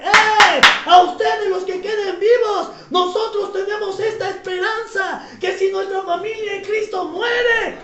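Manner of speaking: rousing preacher's shout; loud proclamation. Acted. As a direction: burst out loud, then keep declaring at full voice. Preacher proclaims the Bible's promise.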